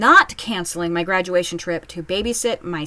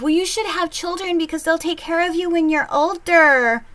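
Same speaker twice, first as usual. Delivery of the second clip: higher, preachy mock; mocking lecturing voice; 8 semitones higher than usual. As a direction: parrot the old argument snidely. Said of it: "the argument of".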